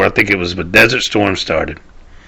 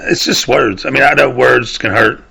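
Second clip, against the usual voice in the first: normal talking voice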